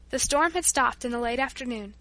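ordinary delivery

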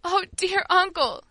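acted anguished appeal; imploring. A narrator's reading. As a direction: draw it out beseechingly. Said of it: "she implored once more".

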